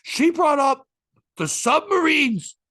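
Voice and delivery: hammed-up outraged complaint, drawn out; upset woman's rant. Comic retelling an upset audience member's complaint, high and loud. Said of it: "She goes".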